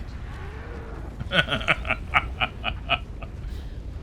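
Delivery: wheezing laugh. Real, not acted. Breathless laughter.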